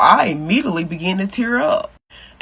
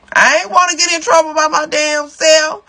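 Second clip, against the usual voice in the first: high-pitched, teary little snitch voice; retold crying; 7 semitones higher than usual. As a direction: pitch it up like a crying kid telling on her sister. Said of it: "with my face full of snot, crying".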